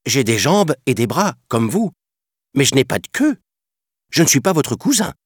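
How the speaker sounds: put-on high child's voice, rising on cousin; protesting boy. Mowgli protesting to the monkey king, high indignant child voice. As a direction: object like an indignant little boy. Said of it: "protesta Mowgli".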